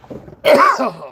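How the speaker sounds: sharp choking cough; coughing up a bug. Real, not acted. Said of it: "Oh, I just swallowed a bug".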